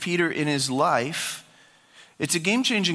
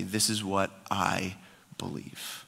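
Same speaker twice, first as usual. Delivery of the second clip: quiet conviction; 12 semitones lower, 9 dB quieter, slower than usual. Acted. Leader declaring personal belief.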